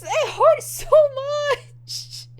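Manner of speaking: drawn-out, high-pitched wail; anguished fangirl wail. Real, not acted. Wailing over a painful scene.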